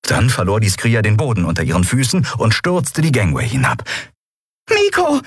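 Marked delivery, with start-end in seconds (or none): 4.7-5.2 very high, urgent cry; called out. Acted. Small companion calling the boy to action, high cry. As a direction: cry the name in a tiny voice. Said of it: "rief Nelen aus".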